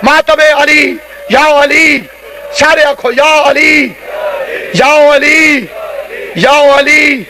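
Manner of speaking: fervent shouted lament from the pulpit; rallying, sustained devotional cries; mourning call. Real, not acted. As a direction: call the congregation to mourn, drawing out each cry. Preacher calls congregation to mourn Ali.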